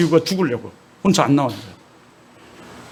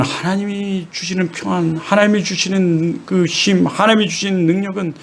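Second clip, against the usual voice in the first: normal talking voice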